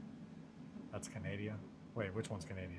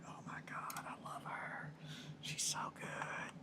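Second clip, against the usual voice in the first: slow, awed whisper; whispered gushing; 9 dB quieter, slower than usual. Retold or imitated. Retelling honeymooners overhearing a Cockney girl, whispered gushing. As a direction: whisper it like a smitten eavesdropper. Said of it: "we were just like".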